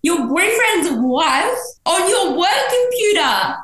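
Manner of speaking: high, slow laughing disbelief; cackling mock outrage; incredulous. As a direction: stretch the question out in baffled laughter. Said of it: "I'm like".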